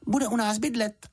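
very high, brisk statement; announcing. A narrator's reading. Juditka tells the housekeeper about the bear, announcing. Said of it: "oznámila Juditka".

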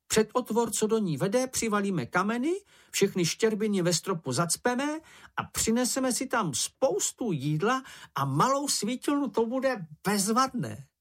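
very high, eager planning voice; excited little creature. Acted. Čenich lays out plans for his cave, excited high voice. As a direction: pipe the plan up high, stretching the final delight.

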